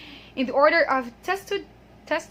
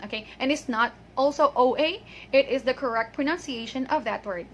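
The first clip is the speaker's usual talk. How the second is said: ordinary talk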